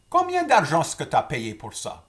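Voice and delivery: grandpa's question. Retold or imitated. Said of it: "He said".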